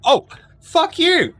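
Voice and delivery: high, loud, drawn-out protest; mock outrage. Real, not acted. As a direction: slowly squeal it at a teasing friend.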